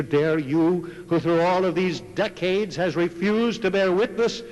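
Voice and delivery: preacher rebuking his silent hearers; fiery preaching. Acted. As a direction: thunder the rebuke from the pulpit. Preaching at full force.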